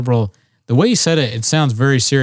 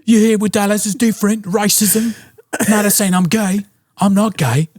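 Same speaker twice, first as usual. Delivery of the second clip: high-pitched whiny impression voice; mock him in a squeaky complaining voice; 9 semitones higher than usual. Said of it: "He's like".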